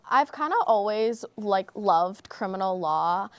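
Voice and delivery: ordinary talk, nothing marked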